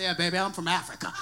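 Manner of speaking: low, quiet, quick flirty line; hushed, low-voiced mock seduction; mock smooth talker. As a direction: play the suave charmer, dropped way down.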